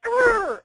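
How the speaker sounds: put-on high, loud playful kid growl; Hulk growl. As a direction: growl like a boy playing Hulk. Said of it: "flexed his arms up like Hulk".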